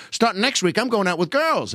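put-on high boyish aside; Lou Costello impression. Comic boy slips in a joke, high voice. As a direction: toss it in like a proud kid. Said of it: "then Lou just throws in".

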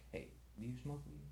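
very soft, quick undertone; furtive question. Retold or imitated. Quietly nudging a girl to ask about weed, furtive. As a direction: sneak the question out under your breath.

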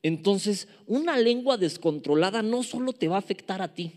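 ordinary talk, nothing marked

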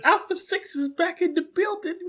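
high-pitched little robot voice; Alpha Six. Retold or imitated. Alpha showing off his new voice chip, little voice. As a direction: pitch it way up, tiny and put-on. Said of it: "hooked him up with a little voice".

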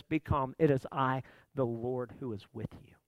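quiet, tender voice of calm; calm reassurance. Acted. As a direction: hush the panic like a steady hand. Jesus speaks calm over panic.

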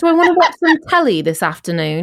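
British accent. Shown as a demonstration. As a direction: play a Coronation Street Brit musing at home. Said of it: "I talk to myself in a British accent".

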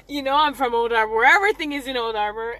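quick, loud Jamaican accent. Real, not acted. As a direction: talk in her Jamaican accent. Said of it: "let me say that without the Jamaican accent".

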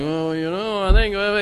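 a hint of a lofty, musing debater; lightly mock the measured intellectual pause; lightly voiced highbrow commentator hesitating; William F. Buckley impression. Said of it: "It's not William F. Buckley, you know, like".

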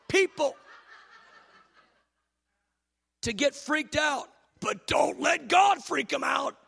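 high, driving pulpit delivery that builds to a shout; full-force preaching. Real, not acted. Preacher challenges the church about freaking people out, full-force preaching.

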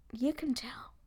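whispered aside; whispering. Real, not acted. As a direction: breathe it out as a whisper. Admitting the accent.

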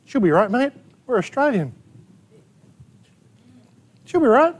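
lightly voiced careless Aussie shrug; mock apathy. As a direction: toss it off like nothing matters. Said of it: "There's apathy".

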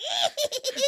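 high demonic cackle; witch cackle. Retold or imitated. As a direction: cackle like the evil Linda. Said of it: "And she's like".

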